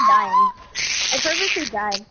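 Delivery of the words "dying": sudden high squeak; squeaky high voice. Real, not acted. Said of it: "You sound like a dying bird".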